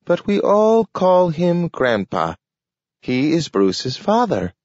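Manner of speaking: high, slow talking dog; a slow, careful talking dog. A narrator's reading. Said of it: "said Nathaniel".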